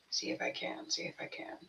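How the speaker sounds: breathy whispered mutter to herself; hushed aside while searching. Real, not acted. Reader whispering to herself while searching files.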